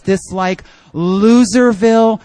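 ordinary talk

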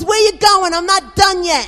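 loud, high demand; imitating a yeller. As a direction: shout her back like a drill sergeant. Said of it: "She was a yeller".

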